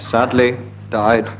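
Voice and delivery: ordinary delivery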